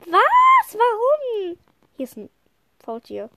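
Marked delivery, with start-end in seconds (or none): 0.1-1.6 very high, loud shocked cry; sudden outraged surprise; shocked outburst. Real, not acted. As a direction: shriek in disbelief. Shocked reaction in game.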